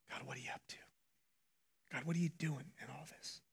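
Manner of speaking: soft, breathy whispered prayer to God; barely voiced, as if asking God privately; hushed prayer. Praying quietly to God, whispered.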